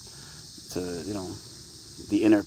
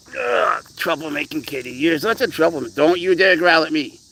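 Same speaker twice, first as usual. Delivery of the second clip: higher, louder mock scolding of a cat; playful scolding; 6 semitones higher, 4 dB louder than usual. Real, not acted. Owner telling off his growling cat, higher and louder. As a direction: tell off a naughty kitty, half laughing.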